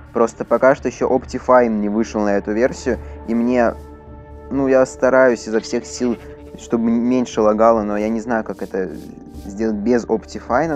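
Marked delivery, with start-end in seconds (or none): none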